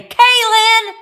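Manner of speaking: high, loud sing-song call of a name; imitating a mom calling her kid from across the house. A mom calling her little daughter home, high and loud. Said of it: "did your mom say".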